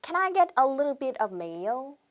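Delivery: slow, careful order phrase; slow classroom demo; slow model sentence. Shown as a demonstration. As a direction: read it word by word for repeating. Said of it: "한 번 더 해볼게요".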